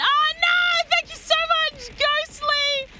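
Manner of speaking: high, loud startled squeal; shocked squeal. Real, not acted. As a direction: caught off guard, squeal it.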